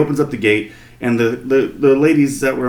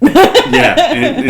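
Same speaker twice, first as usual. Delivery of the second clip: very high, loud laughing yeah; excited yelp; 9 dB louder than usual. Real, not acted. Husband agreeing loudly, very high excited yelp. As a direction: burst in with glee.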